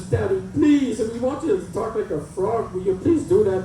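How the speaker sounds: put-on high-pitched begging child; pleading little daughter. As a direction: plead like a little girl wanting a favor.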